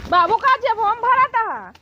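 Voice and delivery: high, loud, stretched call. Acted. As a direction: project it across the house. Calling out, looking for father-in-law.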